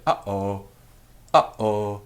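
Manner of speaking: "Uh-oh" with a hard catch in the throat between syllables; glottal stop uh-oh. Shown as a demonstration. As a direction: cut the air off between "Uh" and "oh". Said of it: "just like you'd hear it if you say".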